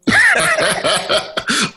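sudden squealing laugh; high cackle. Real, not acted. Teller cracks up at his own story, high squeal. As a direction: burst out in a high giggle.

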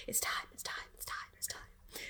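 unvoiced eerie chant; whispering. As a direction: hiss it like a cult chant. Crowd hears whispers.

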